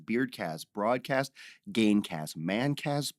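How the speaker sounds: ordinary delivery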